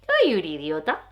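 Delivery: a hint of a dopey cartoon; lightly altered silly voice. Shown as a demonstration. Mocking the thought in a silly voice. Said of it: "Para empezar a hablar así".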